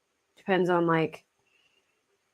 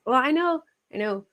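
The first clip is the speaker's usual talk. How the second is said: ordinary talk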